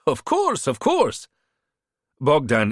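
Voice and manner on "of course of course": put-on higher-pitched, smooth-talking hustler with a foreign accent; charming border fixer. A narrator's reading. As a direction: sell yourself like a friendly tout. Bogdan's held voice.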